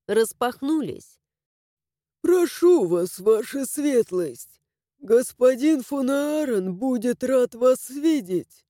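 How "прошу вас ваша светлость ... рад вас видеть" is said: slow, bowing servant voice; courteous old servant. A narrator's reading. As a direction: welcome him deferentially. Servant welcomes the duke.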